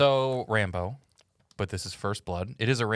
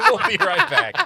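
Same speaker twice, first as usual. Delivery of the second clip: high, loud laughing sign-off; laughing announcer; 6 dB louder than usual. Pretend. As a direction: announce the break through laughter. Mock TV break announcement.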